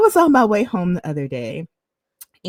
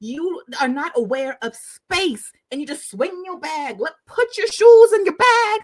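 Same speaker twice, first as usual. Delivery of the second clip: a mock scolding that builds higher and louder, into a shout; indignant rant; 7 semitones higher, 3 dB louder than usual. Retelling a guy telling off a girl on the train, building mock scold. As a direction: scold her about her dirty shoes, getting louder. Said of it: "He was like".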